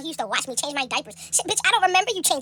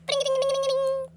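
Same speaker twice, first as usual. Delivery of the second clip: high sing-song ding ding; phone ringtone; 11 semitones higher than usual. Retold or imitated. Imitating her phone ringing as mom calls.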